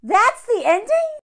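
high, loud, slow disbelief; incredulous question. Real, not acted. Finding the letter just stops, loud incredulous question. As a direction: ask it stunned.

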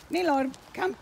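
high, loud call. Real, not acted. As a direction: summon the dog. Calling dog.

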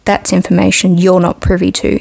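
plain reading voice

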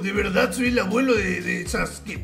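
lightly put-on grandpa voice; trolling as a frail old grandpa; very old man. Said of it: "haciendo la puta voz de viejo".

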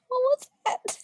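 squeaky high-pitched yelp; a voice crack jumping up into a squeak; startled, squeaky cry. Real, not acted. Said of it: "I just squeaked".

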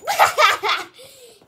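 loud burst of laughter. Real, not acted.

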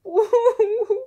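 loud, high boo-hoo howl; crying wail. A narrator's reading. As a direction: wail loudly in a high, acted sob. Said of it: "weinte er". (lautes, hohes Heulen)

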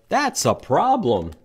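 a bunny's anxious warning; worried bunny. Acted. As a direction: fret about it as the bunny. Bunny worried in picture book.

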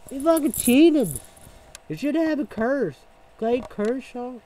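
loud, high indignant shout; a furious, high, loud complaint; angry outburst. Real, not acted. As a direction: blast the cheating in real anger. Angry rant about cheating.

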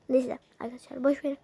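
ordinary talk, nothing marked

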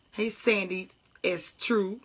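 usual speaking voice

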